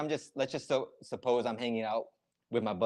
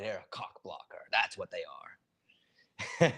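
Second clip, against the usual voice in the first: blunt admission; slower than usual. Shown as a demonstration. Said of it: "just say it".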